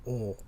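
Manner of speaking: O drawn out as one vowel; the spelling O U said as a long O. Shown as a demonstration. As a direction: hold O long, no U heard. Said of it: "then that would be".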